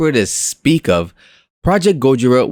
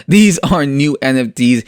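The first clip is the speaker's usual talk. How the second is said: ordinary delivery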